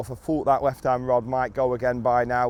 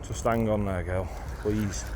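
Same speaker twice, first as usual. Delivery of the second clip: quiet; 5 semitones lower, 5 dB quieter, slower than usual. Real, not acted. Pleading with hooked fish.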